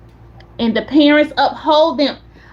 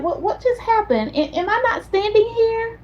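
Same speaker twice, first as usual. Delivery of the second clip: baffled; 9 semitones higher, 4 dB quieter than usual. Retold or imitated. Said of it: "looking around like".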